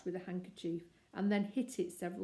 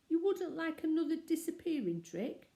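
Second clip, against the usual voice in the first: much higher, bright hopeful ask; 10 semitones higher, 5 dB louder than usual. Acted. Paddington asking Missus Brown for another trick, hopeful. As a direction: fish for one more chance. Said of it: "added Paddington hopefully".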